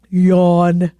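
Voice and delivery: low, loud, toneless drone; bored stiff, exaggerated for effect; mock boredom. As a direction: drone the word as a fake yawn. Mock boredom at article's phrases.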